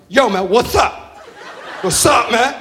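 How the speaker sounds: put-on slow, drawn-out street-kid "yo"; wannabe gangster greeting. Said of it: "This is how he talks to me".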